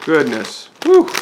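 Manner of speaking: ordinary talk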